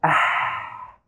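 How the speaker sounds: sharp breathy ah as if touching something hot; unvoiced gasp of ah; whispered hot-touch ah. Shown as a demonstration. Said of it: "You will shout".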